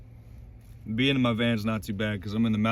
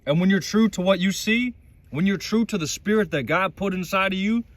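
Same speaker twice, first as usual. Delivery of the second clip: higher, louder, building with conviction; impassioned exhortation; 7 semitones higher, 4 dB louder than usual. Real, not acted. Vlogger vowing to stay true to God, impassioned exhortation. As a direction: preach it like a motivational climax. Defiant motivational climax to viewers.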